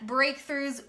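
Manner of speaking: normal talking voice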